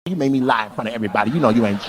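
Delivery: low, quick wifely complaint; imagined angry wife. Retold or imitated. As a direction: mutter it fast like a fed-up wife. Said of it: "She gonna be in the car like".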